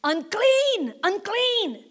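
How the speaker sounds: high, strained warning cry; imitated leper warning shout. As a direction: call unclean to keep people away. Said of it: "The shout of the lepers".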